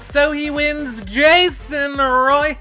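upbeat show host intro. Acted. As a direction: hype up the guest like a host.

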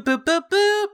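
usual speaking voice

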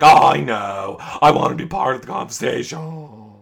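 lightly mocking dopey holler; stupid Kyle voice. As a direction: announce yourself like a doofus butting in. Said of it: "yelling it in his stupid Kyle voice".

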